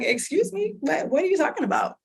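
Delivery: high, startled retold "excuse me, what?"; shocked disbelief. Retelling to the audience the doctor's shock, high and startled. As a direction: replay the moment the news hit, thrown and incredulous. Shocked at hearing treatment plan.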